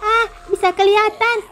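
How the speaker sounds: put-on high, urgent child's voice calling for help; frightened little girl. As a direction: wake everyone up, scared and breathless. Child character Mio panics.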